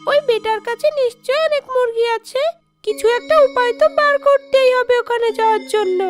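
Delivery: high-pitched witchy ghost voice; high ghost-woman grumbling, then pleased; scheming shakchunni ghost. Acted. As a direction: plot aloud as a greedy high-voiced ghost. Scheming ghost talks to herself.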